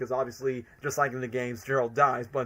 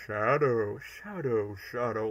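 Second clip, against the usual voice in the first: Gerald voice; 4 semitones lower, 4 dB quieter, slower than usual. Retold or imitated. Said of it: "in his Gerald voice".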